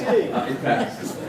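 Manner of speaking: slow words through real laughter; laughing. Real, not acted. Senator still laughing at the accent joke, slow and giggly. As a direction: get it out while still laughing.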